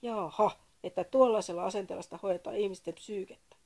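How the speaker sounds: loud, indignant snap; offended caller snapping, loud. A narrator's reading. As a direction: bite back, offended. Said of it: "Sakari tiuskaisi".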